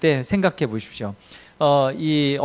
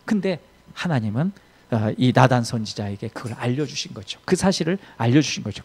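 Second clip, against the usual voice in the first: ordinary delivery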